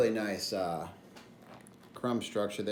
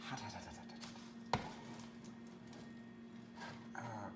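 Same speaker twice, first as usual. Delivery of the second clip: breathy whisper, slowed, wincing at the heat; whispered hot hot; 14 dB quieter than usual. Real, not acted. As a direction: hiss it under breath. Pulling scalding pot from oven.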